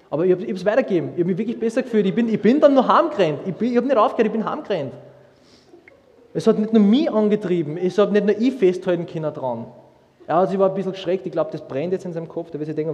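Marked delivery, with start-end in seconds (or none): 0.1-11.3 higher, louder and fast, bubbling over; preacher telling the congregation of his joy, excited and loud. Real, not acted. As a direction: gush about it, still buzzing from the run. Excited retelling of the run.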